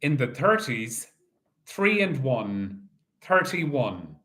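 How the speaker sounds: slow, drawn-out number calling; bingo caller. Acted. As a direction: call each ball out steadily.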